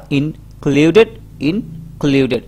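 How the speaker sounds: "Included" slowly as in, pause, kluded; first "included" rising, second falling, both slow and divided; split in parts. Shown as a demonstration. Teacher dividing the word for learners, split in parts. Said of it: "ভাগ করতে হবে এটাকে".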